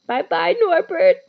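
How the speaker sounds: tearful high loud goodbye, voice breaking; sobbing. A narrator's reading. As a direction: cry out farewell to the baby dragon. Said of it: "Hagrid sobbed".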